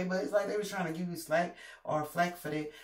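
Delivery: ordinary delivery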